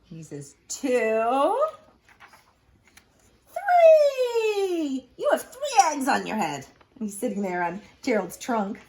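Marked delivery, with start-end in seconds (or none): 0.7-6.7 high, loud, gleeful count; excited Piggy counting. Acted. Piggy counts eggs to Gerald, high and gleeful. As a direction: build up the count with glee.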